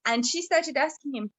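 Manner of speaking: ordinary delivery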